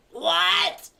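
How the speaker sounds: put-on high, loud old-lady squawk. Deaf old lady yelling back at her daughter, high loud squawk. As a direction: shout it like you heard nothing. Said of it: "the very old lady comes in".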